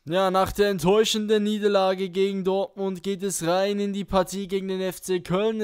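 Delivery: ordinary talk, nothing marked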